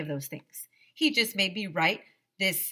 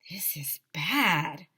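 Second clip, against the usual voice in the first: whisper; slower than usual. Acted. Kids worried on school steps.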